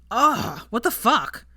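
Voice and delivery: jolted by water in the face; shocked ugh and curse; startled. A narrator's reading. Sprayed in the face.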